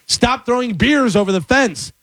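high-pitched retold complaint; imitating yelling neighbor. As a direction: play an irate neighbor calling across. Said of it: "I hear my neighbor yelling".

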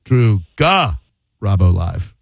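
slow "Garabo" broken as GA... rabo; very slow, low "Drew GA... rabo live"; split-syllable emphasis. Shown as a demonstration. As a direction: punch a detached "GA", pause, then finish "Garabo live". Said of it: "I'm gonna say it another".

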